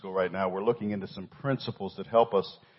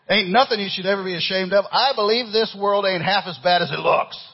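high, loud country-song twang; slight country accent; 10 semitones higher, 8 dB louder than usual. Acted. Preacher reading country lyrics to church, country accent. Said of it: "I got a little country, uh, accent there".

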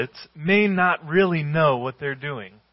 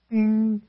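a high sung "Ding" like a cabin chime; 9 semitones higher, 3 dB louder than usual. Retold or imitated. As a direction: imitate the seatbelt chime. Imitating airplane cabin chime.

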